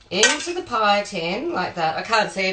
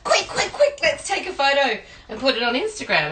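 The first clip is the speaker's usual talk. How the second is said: mock excited squeal, much higher at the start; imitating the wife's giddy rush, pitched up; 7 semitones higher than usual. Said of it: "starts laughing hysterically and says".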